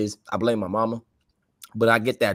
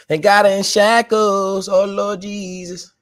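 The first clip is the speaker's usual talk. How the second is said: wailing lament; 10 semitones higher, 5 dB louder, much slower than usual. Real, not acted. Lamenting prisoner news.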